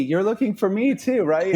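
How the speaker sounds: high, giddy hopeful question; giddy fan. Retold or imitated. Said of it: "I'm like".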